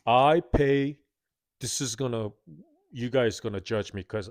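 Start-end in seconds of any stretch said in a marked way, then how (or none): none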